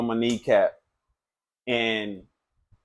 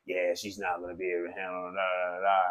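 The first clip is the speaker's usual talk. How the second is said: fast, quieter, dismissive mimicry; mocking the boyfriend; 5 semitones lower, 10 dB quieter, faster than usual. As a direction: brush his words off in a quick mutter. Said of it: "He just".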